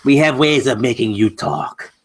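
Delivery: mock villain interrogator; cool foreign spy menace. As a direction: menace him like a movie spy, playfully. Host jokingly threatens to extract spoilers.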